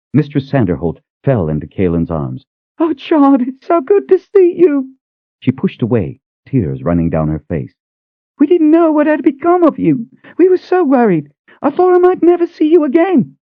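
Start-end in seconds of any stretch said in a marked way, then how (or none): 2.8-5.0 put-on much higher elderly woman's voice, overjoyed; high, choked-up joy of reunion; tearful old cook. Cook hugging Kaelin after long absence, high tearful joy. 8.4-13.4 put-on much higher elderly woman's voice, overjoyed; high, choked-up joy of reunion; tearful old cook. Cook hugging Kaelin after long absence, high tearful joy.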